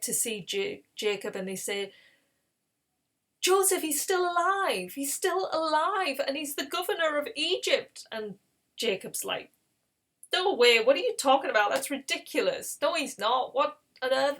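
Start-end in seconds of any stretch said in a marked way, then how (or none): 3.4-8.1 high, breaking-news excitement; acted thrilled report in a raised voice; excited announcement. Brothers bring Jacob amazing news. 10.3-14.4 loud, raised-pitch disbelief; acted astonished scoffing; incredulous. Jacob disbelieves the news.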